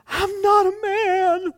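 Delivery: high, slow, tearful squeak; crying boy. Retold or imitated. Young batter fighting back tears, high slow whimper. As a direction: squeak it like a hurt little boy. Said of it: "and thinking".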